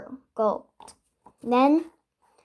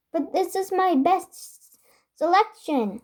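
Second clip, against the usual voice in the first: pitched up, pleading protest; baker protesting; 7 semitones higher than usual. A narrator's reading. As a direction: protest that this is his best. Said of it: "cried the baker".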